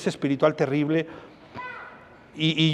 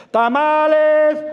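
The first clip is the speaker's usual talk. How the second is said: long drawn-out street cry, loud and high; vendor calling out; 8 semitones higher, 5 dB louder, much slower than usual. Retold or imitated. Tamale vendor calling out to the street at seven a.m., drawn out and loud. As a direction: holler it like a tamale seller.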